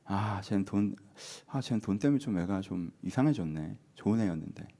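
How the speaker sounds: soft, low murmur of pity. Acted. Pitying a friend changed by money, soft regret. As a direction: lament quietly over a changed friend. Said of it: "아, 안쓰러워야지".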